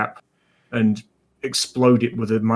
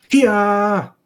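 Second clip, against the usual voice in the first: high, loud cry of triumph; excited shout; 8 semitones higher, 6 dB louder than usual. Real, not acted. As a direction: yell it out as the die lands.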